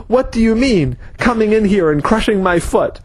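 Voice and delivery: angry man yelling about his crushed foot. Acted. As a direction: shout it up high in outrage. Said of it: "the man was yelling at Wayne".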